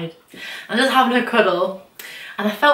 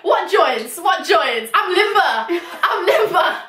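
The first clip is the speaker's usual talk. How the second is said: high, sing-out club whoop; mock party boasting; 8 semitones higher, slower than usual. Bragging to friends about painless clubbing, high gleeful crowing. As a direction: crow it in comic triumph.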